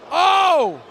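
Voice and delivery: loud startled exclamation; shocked shout. Real, not acted. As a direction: gasp it out as the car flips. Car barrel rolls.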